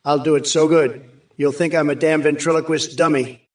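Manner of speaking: usual speaking voice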